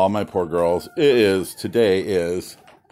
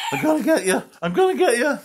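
much higher sing-song threat; chase-game teasing, pitched way up; playful taunt; 5 dB louder, faster than usual. Pretend. Teasing the rooster.

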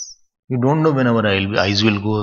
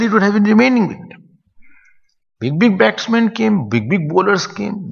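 emphatic; 9 semitones higher, 3 dB louder than usual. Real, not acted. Emphatic point about lost power.

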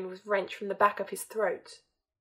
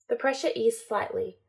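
ordinary delivery

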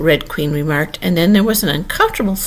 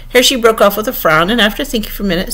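plain reading voice

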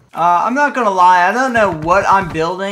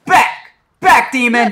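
shouting; 5 semitones higher, 6 dB louder than usual. Acted. Chasing stranger from base.

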